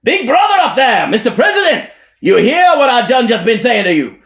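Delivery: high, loud preacher hollering upward; shouting at top of voice. Acted. Elijah shouts to the President above. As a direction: call out to the heavens at full voice. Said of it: "shouts at the top of his voice".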